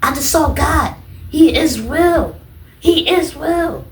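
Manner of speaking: high, loud, slow he is real; slow, high, stunned declaration; awed insistence. Retold or imitated. Telling the nurses.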